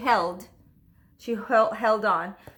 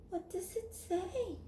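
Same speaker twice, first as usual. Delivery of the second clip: hushed question; 9 semitones higher, 12 dB quieter than usual. Acted. Child curious aside.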